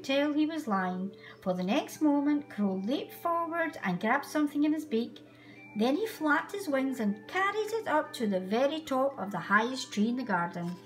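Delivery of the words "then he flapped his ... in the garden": ordinary delivery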